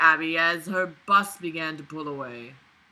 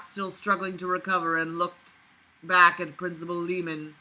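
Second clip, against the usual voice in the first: ordinary talk, nothing marked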